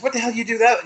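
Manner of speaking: high, shrill-pitched outraged yell; mock angry yell. Yelling at his energy over the blown power. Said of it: "and I said".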